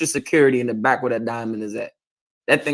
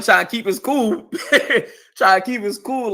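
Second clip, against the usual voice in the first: laughing while talking, pitched high; 7 semitones higher than usual. Real, not acted. Speaker on a brother trying to keep cool, laughing. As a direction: let laughter break into the words.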